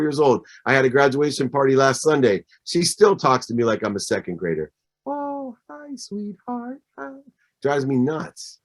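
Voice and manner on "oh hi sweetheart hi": soft high sugary mom voice, slow; mock mother cooing at her grown son; imitating his mom. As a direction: coo it like a mother to a toddler. Mom talking to adult son.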